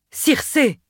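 high, loud call of her name; a god calling out, thrilled; shout. A narrator's reading. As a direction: cry out her name in joy. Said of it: "cria-t-il".

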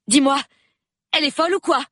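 high, loud indignant outburst; crying out. A narrator's reading. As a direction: cry out in disbelief. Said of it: "s'écria Dinah Lee".

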